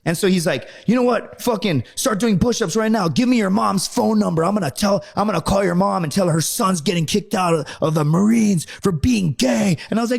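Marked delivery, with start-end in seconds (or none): 0.9-9.8 high, forceful mock threat; drill instructor. Drill instructor threatening to call mom, high mock rant. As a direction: imitate an instructor dressing someone down.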